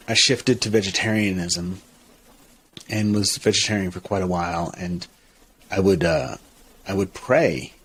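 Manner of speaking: normal talking voice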